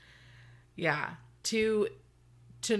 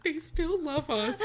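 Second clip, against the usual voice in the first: gushing; 9 semitones higher, slower than usual. Retold or imitated. Mock gushing quote.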